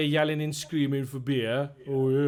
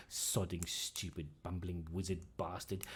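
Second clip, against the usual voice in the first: much softer, lower muttered cursing; muttered curse; 6 semitones lower, 12 dB quieter, slower than usual. Acted. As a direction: grumble about the absent wizard. Barmaid curses the absent owner.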